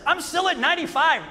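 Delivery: high, smug mock boast from the friend; smug friend quote. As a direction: brag like the unbothered friend. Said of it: "J C says".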